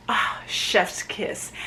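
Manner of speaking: soft slow unvoiced chef's kiss; whispered, drawn-out chef's kiss; whispered praise. Real, not acted. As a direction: breathe out the compliment. Praising the casting.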